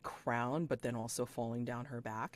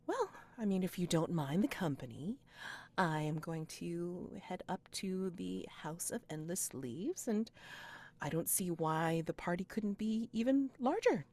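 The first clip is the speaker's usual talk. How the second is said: gracious noble lady; 6 semitones higher than usual. Acted. GM voices Peony Knight inviting.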